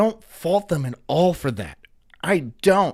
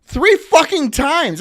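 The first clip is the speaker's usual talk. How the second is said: loud, high, exasperated ranting; furious disbelief, the last slow and hammered; angry rant; 10 semitones higher, 8 dB louder, slower than usual. Real, not acted. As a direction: blow up at how dumb it is. Ranting about idiots.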